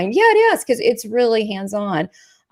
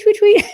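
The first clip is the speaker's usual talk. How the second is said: high, louder chirpy tweet tweet; birdie tweet; 11 semitones higher, 6 dB louder than usual. Retold or imitated. Medium imitating the bird sound spirit sends. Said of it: "then I hear tweet".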